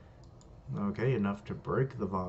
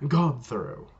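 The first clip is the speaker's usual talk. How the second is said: raised, louder battle cry; attack call; 4 semitones higher, 6 dB louder than usual. Acted. Dimple throwing his opponent, loud attack call. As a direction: call out the move with force.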